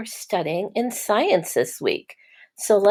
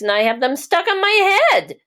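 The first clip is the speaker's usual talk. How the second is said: higher and louder, proud and amazed; excited; 12 semitones higher, 5 dB louder than usual. Acted. Mister Curtis telling Mister Seahorse about his eggs, excited. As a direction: announce it like big news.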